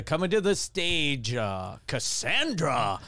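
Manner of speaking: put-on stage announcement with a swooping name; strip club DJ. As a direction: introduce a dancer like a club DJ. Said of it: "so I'd just make up any slutty sounding name".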